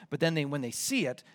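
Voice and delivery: ordinary delivery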